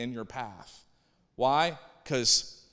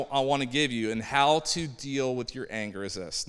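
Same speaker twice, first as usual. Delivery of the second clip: ordinary delivery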